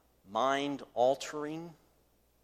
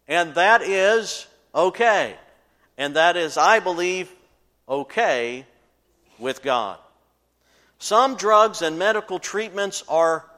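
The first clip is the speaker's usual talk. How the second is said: preaching at full force; 7 semitones higher, 11 dB louder than usual. Acted. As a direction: preach it out over the congregation.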